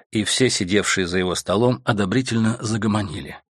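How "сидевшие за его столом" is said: ordinary delivery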